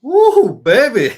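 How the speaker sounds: big, high, loud hype whoop; excited whoop. Real, not acted. Host cheering the guest's talk, a loud high excited whoop. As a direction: cheer like you're opening the show. Host hypes up the live show.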